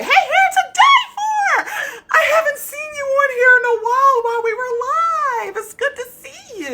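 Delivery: real excited shriek of welcome; excited squeal. Real, not acted. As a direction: squeal with joy at seeing them. Excited greeting to returning viewer.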